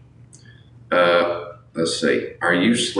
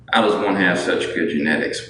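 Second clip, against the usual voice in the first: ordinary talk, nothing marked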